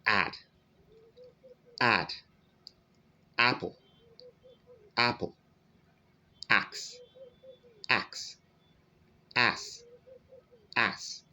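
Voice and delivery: each word said twice, slow and clear, with æ; add, apple, axe spoken as a teacher's model; demonstrating the short æ in examples; modelled examples. Shown as a demonstration. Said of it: "as you can see".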